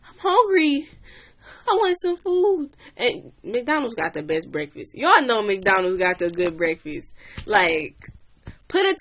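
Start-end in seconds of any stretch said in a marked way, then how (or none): 0.1-2.7 high, put-on childish whine; whiny kid voice. Girl whines to her cussing dad that she's hungry. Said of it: "I'm like".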